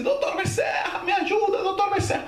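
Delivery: very high, wailing mock cry; desperate plea for help. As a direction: beg a spirit for rescue, hammed-up. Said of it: "Vou pedir ajuda ao doutor Becerra de Menezes". (súplica desesperada encenada, muito aguda)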